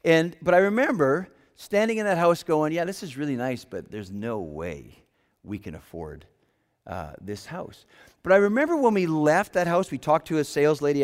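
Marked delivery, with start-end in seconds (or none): 2.7-7.9 quiet doubt. Retold or imitated. Husband standing in the house, quietly doubting they can afford it. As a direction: mutter the doubt under your breath.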